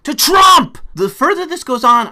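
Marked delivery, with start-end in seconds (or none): 0.0-0.8 slow furious yell, much higher and louder; angry shout. Real, not acted. Warning Democrats about losing votes, shouting angrily. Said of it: "I'm getting even more angry".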